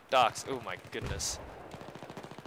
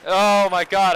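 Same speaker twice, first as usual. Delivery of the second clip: loud, raised-pitch exclamation; real frustrated outburst; 7 semitones higher, 11 dB louder, slower than usual. Real, not acted. Gamer reacting to a bad moment, exasperated outburst. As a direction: burst out louder and higher. Panicked outburst in a shooter game.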